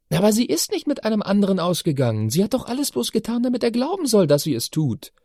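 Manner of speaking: put-on high, eager girl's voice; teenage daughter. A narrator's reading. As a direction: explain it like an earnest teen. Chiara explains opera plot.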